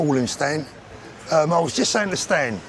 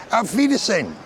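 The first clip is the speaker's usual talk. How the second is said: stern mock German farewell; threatening German; 5 semitones higher than usual. Brit mocks German goodbye, menacing German. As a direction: say it like you're about to shoot. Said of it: "sounds like you're gonna get shot".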